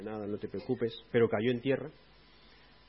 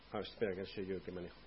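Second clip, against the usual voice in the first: quick, much softer and lower, muttered under the breath; muttered aside; 6 semitones lower, 8 dB quieter, faster than usual. Retold or imitated. Believer mutters to himself that he is in control, softly. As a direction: mutter it fast like a self-satisfied aside.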